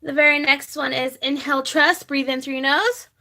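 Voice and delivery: guided breathing. Acted. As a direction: guide viewers through each breath, unhurried. Leads a breathing exercise.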